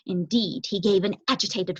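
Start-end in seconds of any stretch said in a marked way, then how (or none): none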